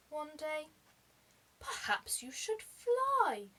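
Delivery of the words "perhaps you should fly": high, slow creature voice; a gentle, odd beast with a high, slow voice. A narrator's reading. Creature advising the penguin, high and slow. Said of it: "said the never was an ocerus".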